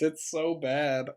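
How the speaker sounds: ordinary talk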